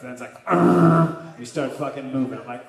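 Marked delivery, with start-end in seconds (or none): 0.4-1.4 loud higher roar of frustration; frustrated roar. Acted. Comedian panicking as ship sails, loud roar. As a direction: let out a big panicked groan.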